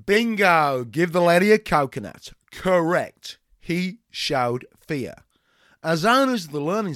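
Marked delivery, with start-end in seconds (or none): 0.1-2.3 loud cheery praise; excited. Acted. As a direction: cheer her on like a gameshow host. Trainer praising answer.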